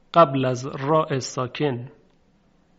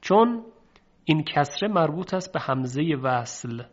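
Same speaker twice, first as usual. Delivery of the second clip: normal talking voice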